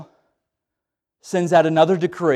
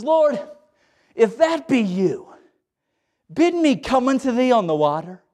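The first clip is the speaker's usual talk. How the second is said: eager Peter; 5 semitones higher than usual. Acted. Peter voiced asking Jesus.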